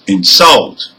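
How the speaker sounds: "insult" stressed on SULT, as a verb; last-syllable stress. Shown as a demonstration. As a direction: punch the end of "insult". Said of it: "have stress on the".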